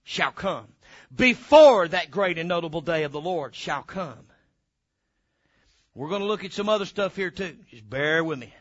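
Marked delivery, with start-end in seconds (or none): 1.1-4.3 "before" punched, then a lower run to the end; stress on before. Shown as a demonstration. Preacher driving the verse home, hard stress on "before". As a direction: lean hard on "before" and let the verse fall away. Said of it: "put some emphasis on that word before".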